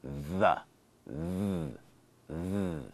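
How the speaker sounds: The with a buzzing voiced th, repeated; voiced th demo. Shown as a demonstration. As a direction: buzz the tongue between the teeth on the. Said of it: "make a noise in your throat".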